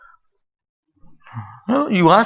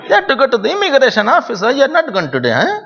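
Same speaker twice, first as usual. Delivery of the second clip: put-on Indian father's accent; 12 semitones higher than usual. Father questioning child about the office, high Indian accent. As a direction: imitate a father's thick Indian accent, pitched way up. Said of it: "father, totally Indian accent".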